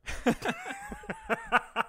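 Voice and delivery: high squealing laugh. Real, not acted. Laughing after reading text.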